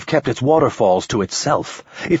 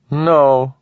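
alien voice; 6 semitones higher, 4 dB louder than usual. Acted. Alien refuses.

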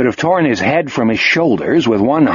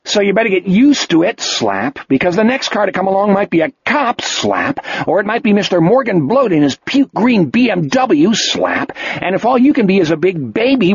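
higher, fast angry berating; furious scolding; 6 semitones higher than usual. A narrator's reading. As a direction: hammer each phrase at him. Jack slapping and berating Wolf.

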